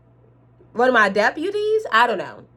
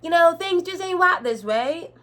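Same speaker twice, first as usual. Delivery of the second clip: high, put-on country twang; hammed-up country sheriff accent; 8 semitones higher than usual. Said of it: "I'm gonna cut the accent".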